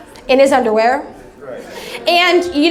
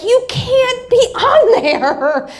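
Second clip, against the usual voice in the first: imitating Tom; 10 semitones higher, much slower than usual. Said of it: "when Tom was like".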